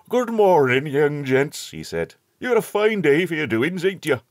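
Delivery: put-on a hearty old countryman calling out; old rustic shepherd. A narrator's reading. Old shepherd greets the boys.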